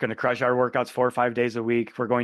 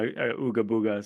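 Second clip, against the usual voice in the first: caveman voice; 5 semitones lower, 3 dB quieter than usual. Retold or imitated. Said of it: "she calls me a".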